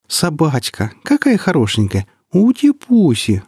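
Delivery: put-on high, slow baby talk; cooing over a puppy. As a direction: gush at a cute little dog. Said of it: "умилилась Анискина".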